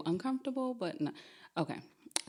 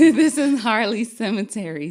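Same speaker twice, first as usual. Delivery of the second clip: laughing out the words, louder and higher; can't hold back a laugh while speaking; 5 semitones higher, 9 dB louder than usual. Real, not acted. Storyteller cracking up with listeners mid-story, laughing.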